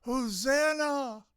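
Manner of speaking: high ringing shout of Hosanna. Retold or imitated. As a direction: call it out high like a crowd. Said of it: "they were shouting".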